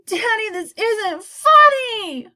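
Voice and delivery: put-on slow, loud, high child's shriek. A narrator's reading. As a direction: shriek it at dad in outrage. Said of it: "Augie shrieked".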